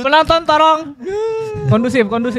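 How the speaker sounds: hammed-up appeal to the crowd; mock call out. Calling out to the audience.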